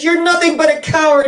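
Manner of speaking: hammed-up angry Dorothy calling the lion a coward; mock scolding Dorothy. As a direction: scold him like a cross girl. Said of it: "She says".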